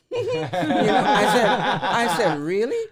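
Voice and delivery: laughing out loud while retelling; big real laugh through the words. Real, not acted.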